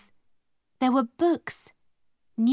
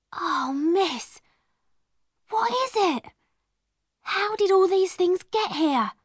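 put-on high, wondering girl's voice; an awed young servant girl; lightly voiced young maid; 8 semitones higher than usual. Becky, the maid, asking Zara in wonder.

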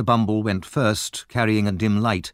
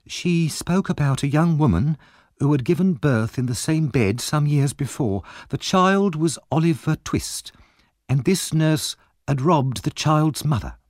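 higher-voiced shrewd wife bargaining; Mrs Bumble; 7 semitones higher than usual. A narrator's reading. Wife bargains with Monks for money, higher-pitched shrewd voice. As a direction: push for payment in a higher wife's voice.

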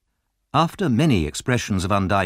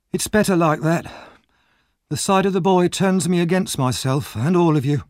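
bitter Nancy; 9 semitones higher, 4 dB louder than usual. Acted. Nancy bitterly wishing the boy dead. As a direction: wish it darkly.

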